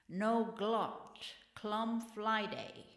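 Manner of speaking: slow, mock Chinese accent; mock Chinese pusher accent. Pusher refusing an Occidental junkie, mock Chinese accent. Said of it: "a lot of Chinese pushers".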